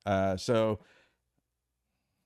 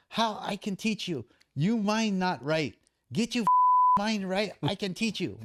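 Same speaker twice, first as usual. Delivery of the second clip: imitating Thai trainer; 10 semitones higher than usual. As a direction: play the stern Thai coach scolding, high and halting. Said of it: "He goes".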